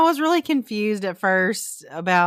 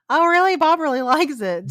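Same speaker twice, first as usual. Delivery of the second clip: surprised; 9 semitones higher than usual. Retold or imitated. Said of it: "And I was like".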